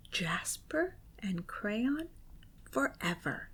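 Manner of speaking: slow, very soft low whisper; spine-chilling whisper; creepy whisper. A narrator's reading. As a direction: breathe it out like a haunting promise. Crayon's creepy message.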